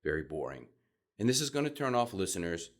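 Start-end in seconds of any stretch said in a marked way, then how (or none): 0.0-0.7 gloomy dropping delivery; depressing low. Shown as a demonstration. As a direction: lower and softer, sinking dully. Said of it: "sounds very depressing, very sad".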